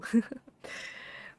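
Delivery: breathy amused laugh. Real, not acted. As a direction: burst of unvoiced laughter. Laughing at own mistake.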